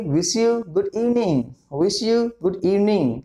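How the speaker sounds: very slow, word by word; slow, clear teacher model; slow demo. Shown as a demonstration. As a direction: say it slowly for learners. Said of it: "क्या कहूंगा भाई".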